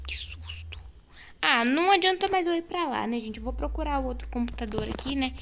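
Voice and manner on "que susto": breathless whispered relief. Real, not acted. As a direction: hush it out after the scare. Same words, whispered after the scare.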